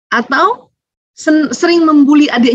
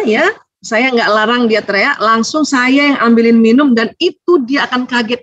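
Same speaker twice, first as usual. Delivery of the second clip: ordinary delivery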